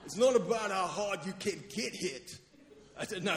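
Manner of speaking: amateur film-boxer impression; Rocky. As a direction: do a movie boxer giving a pep talk. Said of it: "that's my Rocky impression".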